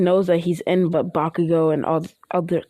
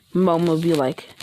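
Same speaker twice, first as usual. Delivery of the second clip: ordinary delivery, nothing marked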